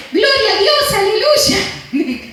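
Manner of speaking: high-pitched mock cry of praise, slowing at the end; hammed-up imitation of a sister's exclamation; imitated praise shout. Preacher mimics her sister hitting herself with a hammer, high praise shout. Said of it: "Dice así cada vez".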